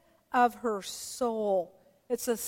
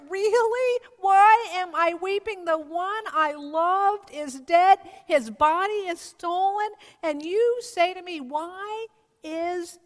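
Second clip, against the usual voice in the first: distraught protest; 3 semitones higher, 4 dB louder than usual. Retold or imitated. Imagined Mary answering the angels in a high, loud anguished protest. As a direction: cry out, then sink into exhaustion. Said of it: "I can imagine her".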